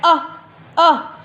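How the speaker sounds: अ as a short clipped uh; short u sound. Shown as a demonstration. Teacher gives the short phonic sound of U, a clipped uh. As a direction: say अ short and open. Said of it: "यू का phonic sound क्या है".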